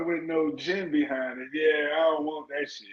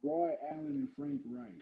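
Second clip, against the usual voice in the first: muffled; 16 dB quieter than usual. Real, not acted. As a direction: voice smothered behind a hand. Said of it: "Why he sound like he's muffled?".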